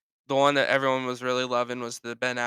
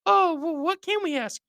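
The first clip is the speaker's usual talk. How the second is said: very high, loud falsetto protest; mock frustrated gossip in a squeaky voice; put-on falsetto complaint; exasperated onlookers; 5 dB louder than usual. Same onlookers, now frustrated.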